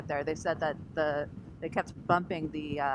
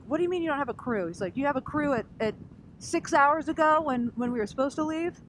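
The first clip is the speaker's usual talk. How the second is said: high, fast mock outrage; imitating irate guy; 9 semitones higher than usual. Angry passenger yelling at airline staff, imitated high and fast. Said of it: "I called him irate guy".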